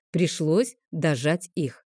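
plain reading voice

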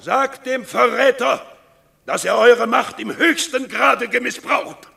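hoch und laut vor den Herren angeklagt; wütende Anklage. Acted. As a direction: voller Zorn den Verrat ausrufen. Aufidius klagt Coriolan öffentlich an. (Aufidius klagt Martius vor den Herren wütend an)

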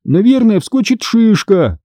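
put-on high, plaintive child's voice; whiny complaint; tearful whining.

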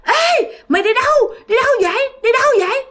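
high mocking demon voice calling out; demon taunting. The demon mocking Thi while chasing her, high taunting call. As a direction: tease her like a ghost giving chase. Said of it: "nó kêu".

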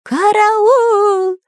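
high, drawn-out alarm squawk; parrot's scream for help. A narrator's reading. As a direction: shriek for help like a panicked parrot. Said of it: "завопил Кутя-Плютя". (истошный вопль попугая)

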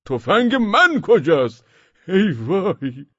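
high, loud, drawn-out yell; alarmed shout. A narrator's reading. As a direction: yell in dismay, stretching the words. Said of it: "داد زد". (داد بلند و سراسیمه)

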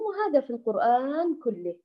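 normal talking voice

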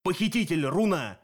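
lightly voiced accusing monster; spluttering cyclops. A narrator's reading. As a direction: accuse as an angry cyclops, a touch forceful. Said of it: "отплёвывался Полифем".

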